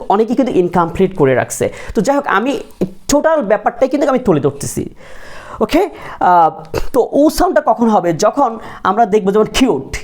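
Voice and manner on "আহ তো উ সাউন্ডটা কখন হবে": normal talking voice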